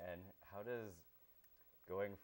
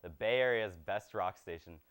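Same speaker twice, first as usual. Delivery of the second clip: upbeat radio-voice sales pitch, a touch louder; radio audition pitch; 2 semitones higher, 10 dB louder than usual. Acted. Applicant pitching himself to the rock station, upbeat DJ voice. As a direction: sell yourself to the station like a DJ.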